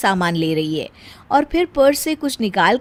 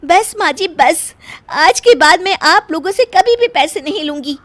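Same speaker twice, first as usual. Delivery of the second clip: higher, louder huffy declaration; offended bahu; 6 semitones higher, 5 dB louder than usual. Acted. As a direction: draw the line, proud and hurt. Meena declares to mother-in-law. (सास से रूठकर ऊँची आवाज़ में ऐलान)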